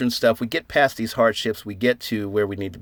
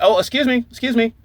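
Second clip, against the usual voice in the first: polite interrupting; 11 semitones higher, 4 dB louder than usual. Retold or imitated. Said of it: "somebody's like".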